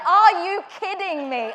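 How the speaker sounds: loud, high incredulous outburst; can't believe he's asking that; exasperated disbelief. Retold or imitated. Market stallholder asks where she's from, loud disbelief.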